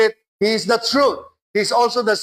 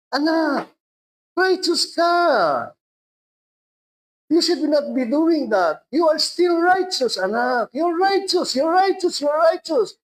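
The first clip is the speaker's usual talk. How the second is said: loving Holy Spirit; 6 semitones higher than usual. Retold or imitated. The Holy Spirit assuring a sinful child, high and insistent. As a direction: lift him up like a proud father insisting. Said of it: "sasabihin ng Holy Spirit".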